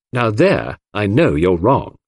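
ordinary delivery, nothing marked

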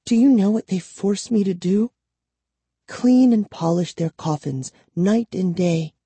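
put-on high, plaintive girl's voice; distressed captive girl. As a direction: lament your forced labour, pitched high.

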